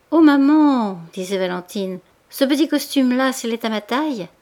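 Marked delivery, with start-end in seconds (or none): 0.1-1.0 put-on higher, louder girlish appeal; eager daughter. A narrator's reading. Valentine appeals to her maman about a costume, eagerly. 2.3-4.3 higher, louder start, easing off; eager daughter. A narrator's reading. Daughter asks maman about the little costume, eagerly.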